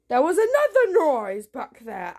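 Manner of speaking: real alarm, voice swooping high. Real, not acted. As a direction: jump at another unexplained noise. Alarmed by another noise.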